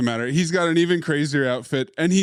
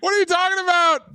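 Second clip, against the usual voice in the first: shouting; 13 semitones higher, faster than usual. Real, not acted. Heated argument about exposition.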